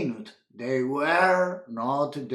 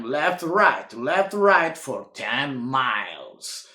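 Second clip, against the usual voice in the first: usual speaking voice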